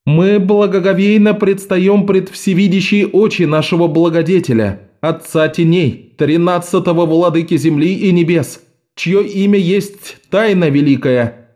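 high, ceremonial exclamation; solemn priestess exclaiming. A narrator's reading. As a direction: proclaim it like a temple priestess. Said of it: "воскликнула женщина".

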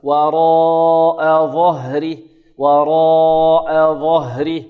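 slow chant holding the ā in وراء very long; connected madd, four counts. Shown as a demonstration. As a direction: draw out وراء, then stop on ظهره. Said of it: "هذا مد متصل أربع حركات". (تلاوة بمد الألف في وراء أربع حركات)

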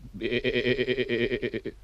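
goat bleat. Shown as a demonstration. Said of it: "Luister maar".

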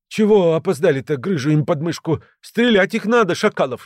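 high-pitched, agitated hiss, not hushed; hissed outburst. A narrator's reading. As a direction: spit it out in indignation. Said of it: "негромко прошипел Грыжа".

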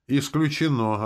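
high, loud, firm rejection; curt refusal. A narrator's reading. Leader refusing to betray the demon, curt. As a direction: cut the idea off firmly. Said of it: "отрезал я".

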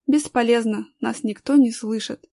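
plain reading voice

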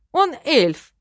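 high, loud sulky retort; pouting, indignant correction; huffy snort. A narrator's reading. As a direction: throw it back loudly in a huff. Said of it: "фыркнул хранитель, насупившись".

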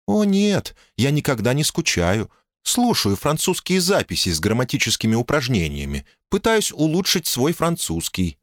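calm, sensible woman speaking up high; woman's voice. Acted.

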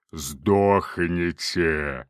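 very slow, low demonic bellow; demonic roar. Acted. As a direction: drag it out like a demon. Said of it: "заревел демон".

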